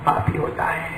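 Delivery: whispered, voiceless. Real, not acted. Preacher on a father's love, whispered. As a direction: breathe it out.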